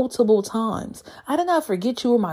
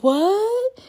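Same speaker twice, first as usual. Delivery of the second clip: incredulous what; 13 semitones higher than usual. Real, not acted. Disbelief at cousin's ex.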